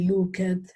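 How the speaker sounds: "looked" in two syllables, loo-ket; wrong pronunciation. Shown as a demonstration. Teacher warning students off a mistake, mispronounced. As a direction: give "looked" a full -et ending. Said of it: "Yo no puedo decir".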